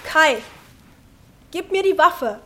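sehr hohe, alarmierte Stimme; angstvolles Flehen. Acted. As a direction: flehe den Bewaffneten eindringlich an. Fleht Bewaffneten an. (ängstlich flehend)

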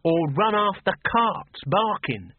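put-on high, slow, fretting old woman; a fussing mother, high and slow; fussy old mother. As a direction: nag like a worried old mum.

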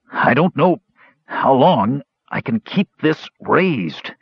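strained gasps, broken by pauses; gasping with strain. A narrator's reading. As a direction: force the words out while holding a heavy weight. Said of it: "he gasped".

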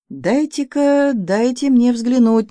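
high, stretched-out eager plea; impatient cry. A narrator's reading. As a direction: plead eagerly, drawing out the words. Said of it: "нетерпеливо вскричал Добраницкий".

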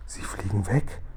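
softly, under the breath; hushed near-whisper. A narrator's reading. Sophiechen watches the dream bubbles drift off, hushed. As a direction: hush it like a quiet discovery. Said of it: "flüsterte Sophiechen".

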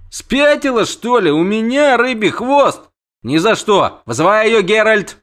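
high, loud outraged prince; indignant prince. Acted. Prince rejects the idea of a tail, indignant.

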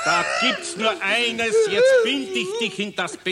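high, slow shouted threat; exasperated shout. Acted. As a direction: bellow it, fed up. Carpenter loses patience with goblin.

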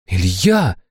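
ordinary delivery

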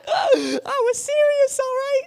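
very high drawn-out fake sob; hammed-up tearful squeal; mock crying. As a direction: whine it slowly in a squeaky mock cry. Acts hurt after being laughed at.

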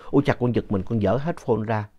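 ordinary delivery

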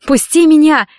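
high, loud desperate demand; struggling cry for release; strained plea. A narrator's reading. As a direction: force it out with your last strength. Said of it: "выдавила Кэтрин из последних сил".

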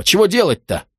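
high-pitched exasperated question; yelled protest. A narrator's reading. Suspect asks police what to do, high yelped protest. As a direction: plead in confusion, pitch jumping up. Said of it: "вопил человек возле пикапа".

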